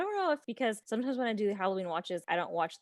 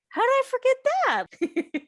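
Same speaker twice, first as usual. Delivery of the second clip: much higher, louder, rising disbelief; shocked disbelief; 7 dB louder than usual. Retold or imitated. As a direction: squeal it in shocked surprise. Shocked at forgetting plot.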